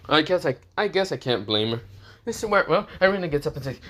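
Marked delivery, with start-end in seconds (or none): none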